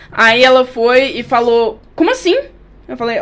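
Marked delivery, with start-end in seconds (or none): 2.0-2.4 high, disbelieving question; a shocked how-dare-you; passenger, told to get out, asks in high disbelief; indignant. Retold or imitated.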